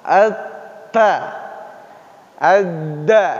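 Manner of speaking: Al-ta and Al-da said with their letter names; Arabic letters. Shown as a demonstration. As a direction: contrast the two letters. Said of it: "Kalau menempel beda".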